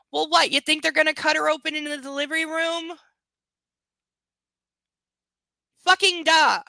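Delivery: loud, high-pitched incredulous outburst; exasperated sarcasm. Real, not acted. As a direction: call out the obvious, loudly exasperated. Reader mocks the story's characters.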